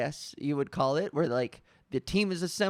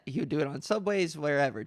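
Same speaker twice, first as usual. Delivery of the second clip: ordinary delivery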